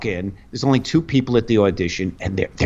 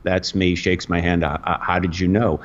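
calm and sweet; 6 semitones lower than usual. Retold or imitated. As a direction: answer calmly and kindly. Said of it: "very calm, very sweet".

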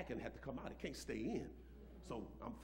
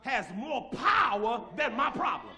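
loud, slow, ringing preacher's shout; full-force preaching; 10 semitones higher, 14 dB louder, slower than usual. Acted. Pastor shouting his faith to the congregation, full-force preaching. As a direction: preach it to the rafters.

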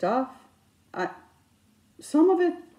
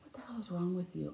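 hushed rebuke; 13 dB quieter, faster than usual. Retold or imitated. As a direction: mutter it quickly, imitating an unimpressed husband. Husband's hushed reply to her yell.

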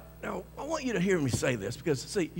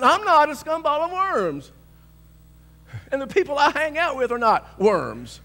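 much higher and louder, defiant; loud indignant retort; 10 semitones higher, 8 dB louder than usual. Retold or imitated. Preacher answering the insulter, defiant loud retort. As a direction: push back with full indignation.

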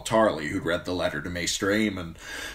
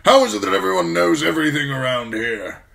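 put-on loud, high, exasperated old officer; grumbling old commander; 10 semitones higher, 9 dB louder than usual. A narrator's reading. Commander complains about gossip at the castle, loud grumbling. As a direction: complain loudly at the lack of secrecy. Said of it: "Mormont grumbled".

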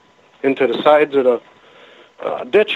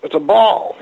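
imitating her startled cry, higher on "a ball"; halting start, then a higher-pitched mock exclamation; retold exclamation; 6 semitones higher than usual. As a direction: act out her blurted discovery, lightly. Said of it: "she goes".

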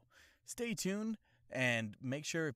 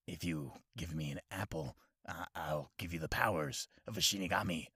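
death god voice; 6 semitones lower than usual. Retold or imitated. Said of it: "anime impression video".

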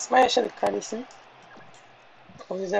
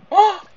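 frustrated shout; 10 semitones higher, 9 dB louder than usual. Real, not acted. Missed shot hits the post.

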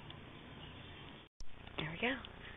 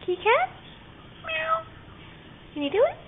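pet talk voice; 11 semitones higher, 4 dB louder than usual. Real, not acted. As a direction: coax the cat to answer. Coaxing cat to talk.

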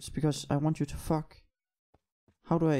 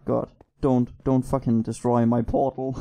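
ordinary talk

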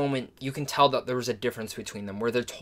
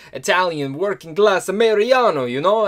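a hammed-up, louder, higher Italian American accent; bad Italian American accent; 5 semitones higher, 8 dB louder than usual. Reviewer imitates the movie's Italian American driver, bad Italian American accent. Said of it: "that's a bad accent".

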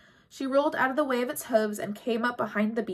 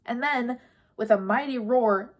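ordinary delivery

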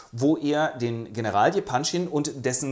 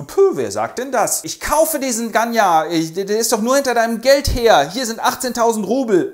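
loud, higher showy offer; brash bidding; 9 semitones higher, 7 dB louder than usual. Acted. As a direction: fling money around. Rogoschin bietet Geld für sie.

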